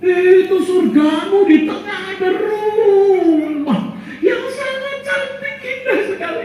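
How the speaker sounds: very high, slow sing-song awe; amazed wonder. Acted. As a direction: marvel at the heavenly house. Sermon voices amazed man in paradise. (takjub melihat rumah surga)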